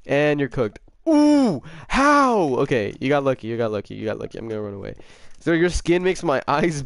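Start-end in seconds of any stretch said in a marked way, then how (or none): 1.1-1.6 high, loud whoop of alarm; sudden falling yelp; startled "ooh". Real, not acted. Player caught in a game fight, startled whoop. 1.9-2.4 high, loud one-word yell; incredulous outburst. Real, not acted. Player whose opponent got lucky, incredulous yell. Beaten in a game fight.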